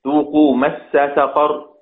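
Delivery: مس سقر slow, both sin sounds held apart; slow demonstration of izhar; clear separation. Shown as a demonstration. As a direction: recite slowly with izhar. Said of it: "إظهار".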